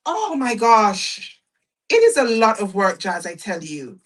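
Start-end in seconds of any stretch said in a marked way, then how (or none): none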